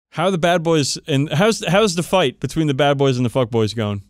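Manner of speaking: ordinary delivery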